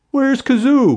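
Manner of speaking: high, loud angry demand. A narrator's reading. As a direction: hurl the question at him. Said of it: "one demanded".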